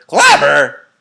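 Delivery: loud high indignant cry; old man scoffing at too small a word; loud scoffing exclamation; cried out. A narrator's reading. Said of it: "cried the old man".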